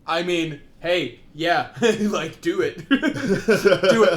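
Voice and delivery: laughing loudly, egging on. Real, not acted. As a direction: cheer it on through laughter.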